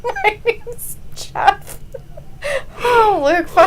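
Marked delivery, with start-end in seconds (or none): 0.0-1.8 high, soft, slow fake Spanish accent; laughter-strained attempt at a Spanish accent; mock Spanish accent. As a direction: squeeze out a bad Spanish accent slowly. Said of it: "trying to speak with his Spanish accent".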